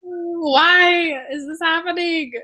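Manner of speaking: half-joking wail at fate; mock despair. Imagining hearing of death.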